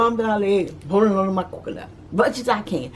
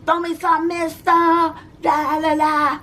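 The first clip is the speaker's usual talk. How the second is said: mock parade crowd shout; 10 semitones higher, slower than usual. A kid mimicking the crowd begging for throws, half-sung. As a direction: call out to the float riders.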